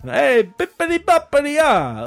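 high, hammy mock Italian; fake Italian accent. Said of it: "I'm doing my fake Italian thing".

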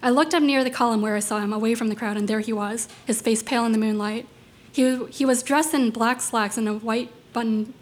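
plain reading voice

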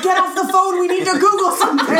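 high, loud, hurried shout; urgent yell. Acted. Police officer to colleague tying up the phone, urgent yell. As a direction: yell at a colleague hogging the line. Acting someone yelling about phone line.

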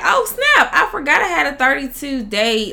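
high-pitched "oh no" realization, settling quickly; retold surprise. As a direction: act out suddenly remembering, pitch leaping then dropping.

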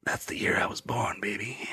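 soft whispered aside. Acted. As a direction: breathe it out close.

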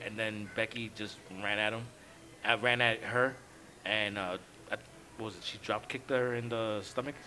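hushed, soft storytelling voice; whispering. Real, not acted. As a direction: drop to a low hushed voice. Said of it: "Why are we whispering?".

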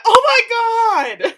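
high, loud, drawn-out shriek; kids' horrified outcry. Retold or imitated. Kids watching the gory scene, imitated horrified outcry. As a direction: shriek it in exaggerated horror. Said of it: "My kids watched it and went".

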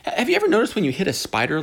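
ordinary talk, nothing marked

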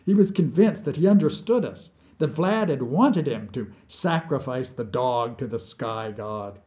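ordinary delivery

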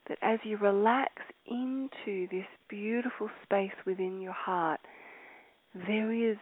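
ordinary talk

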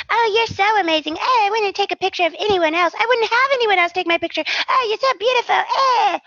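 overly sweet fake flattery; gushing mock voice. Mocking gushing character dialogue.

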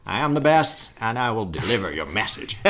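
put-on English accent, proud and grand; English pigeon boasting. The English pigeon promises Flantier, in a grand English accent.

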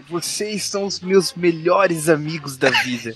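put-on slow, slurred drunk affection; drawn out like a drunk friend. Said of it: "cena de bêbados andando na rua".

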